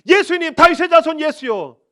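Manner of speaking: loud high plea to Jesus; desperate pleading shout; crying out. Acted. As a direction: cry out with everything. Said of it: "라고 부르짖었습니다".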